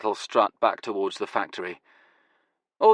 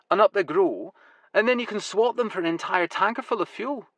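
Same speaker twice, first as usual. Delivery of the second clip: higher, quicker, pleased voice; proudly explaining his own system; Huey's voice; 9 semitones higher than usual. Acted. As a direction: enthusiastic eccentric. Huey explains the alternative economy.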